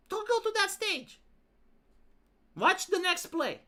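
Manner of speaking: high-pitched mock alarm; hammed-up warning, voice pitched high; mock horror warning. Mocking the narrator's horror talk about plays, mock horror warning. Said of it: "talking as if it's a horror".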